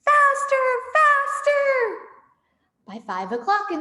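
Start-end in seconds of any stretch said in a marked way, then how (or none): 0.1-2.0 much higher, loud manic yelling; frantic shout. Acted. Fat Bogus shouting at his men to dig faster. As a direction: whip them on like a madman. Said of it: "hopping about like a dervish and shouting".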